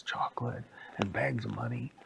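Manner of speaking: usual speaking voice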